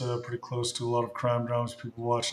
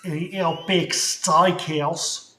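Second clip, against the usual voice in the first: drawn-out, slow fake Australian accent; slow hammed-up Australian accent; slow mock Aussie; 3 semitones higher, 5 dB louder, slower than usual. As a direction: stretch it out like a cartoon Aussie. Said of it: "is that still Aussie".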